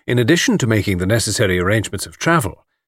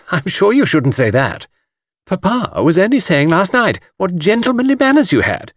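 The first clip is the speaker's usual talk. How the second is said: higher, earnest feminine protest; much distressed; 9 semitones higher than usual. A narrator's reading. Miss Taylor defending his good manners, mildly distressed. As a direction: reassure him with fussy concern. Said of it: "much distressed".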